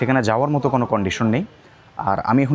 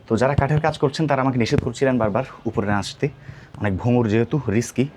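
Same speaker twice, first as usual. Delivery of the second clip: usual speaking voice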